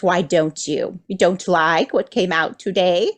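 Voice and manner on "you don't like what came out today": ordinary talk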